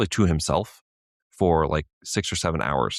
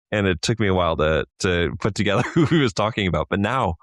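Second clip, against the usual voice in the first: ordinary talk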